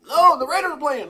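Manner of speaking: imitating wife. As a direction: mimic her refusing the trip. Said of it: "She's like".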